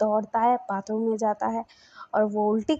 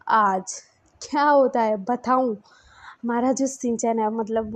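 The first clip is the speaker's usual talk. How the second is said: ordinary talk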